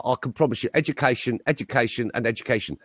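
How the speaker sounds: lightly imitated Essex accent; flattened Essex vowels on a politician's promise; Tony Blair. Politician promising education to voters, lightly put-on Essex accent. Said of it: "flattened his vowel sounds to sound Essex".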